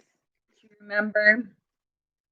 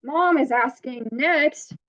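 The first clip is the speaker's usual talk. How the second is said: ordinary talk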